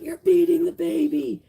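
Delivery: high, loud, mock-panicked accusation; hammed-up outraged commenter; mock freaking-out viewer. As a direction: squeal it like a shocked busybody. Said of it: "She'd probably be freaking out saying".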